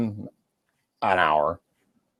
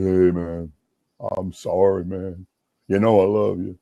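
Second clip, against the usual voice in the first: subdued apology; 6 semitones lower than usual. Retold or imitated. Friend apologises at the sports bar, low and sincere. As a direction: make up with him, voice dropped low.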